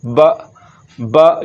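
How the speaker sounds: "बा" cut short with a jolt; abrupt, jolted "बा"; with a jerk. Shown as a demonstration. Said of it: "ये झटके के साथ पढ़ा जा रहा है".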